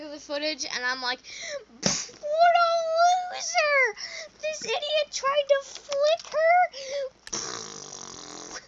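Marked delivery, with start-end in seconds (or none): 1.8-8.6 very high, loud, drawn-out taunt; exaggerated mocking hoot; jeering mockery. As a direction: ridicule him at full squeal. Mocking friend over the footage.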